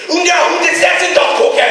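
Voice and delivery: high, loud barked German; mock menacing German shout; threatening German. Shown as a demonstration. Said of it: "And the dog is eating the cake".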